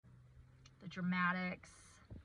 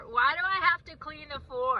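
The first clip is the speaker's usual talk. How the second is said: put-on high, loud whiny kid protest; exaggerated complaining-kid voice; defiant kid; 6 semitones higher, 7 dB louder than usual. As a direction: mimic a child whining about chores. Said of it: "If he's saying".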